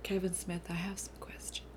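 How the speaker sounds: conspiratorial unvoiced whisper. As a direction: lean in and whisper it like a secret. Dramatic aside to the director.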